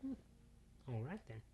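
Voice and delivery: soft, quick, hushed thinking voice; inner thought. Acted. Daughter thinking to herself about her father, hushed. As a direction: muse to yourself under your breath. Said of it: "thought to herself".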